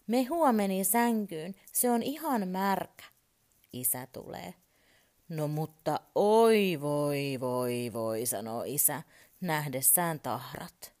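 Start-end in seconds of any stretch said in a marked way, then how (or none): 0.1-3.0 put-on high, loud little boy's complaint; child calling out. A narrator's reading. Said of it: "hän huutaa taas". 5.3-8.3 dismayed father. A narrator's reading. Father dismayed at spilled juice.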